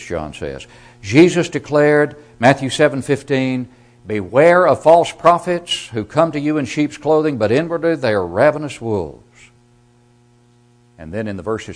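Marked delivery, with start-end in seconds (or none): 1.0-9.2 loud, high, impassioned pulpit delivery; preaching at full force. Acted. Preacher warning his congregation of eternal ruin, full-force preaching.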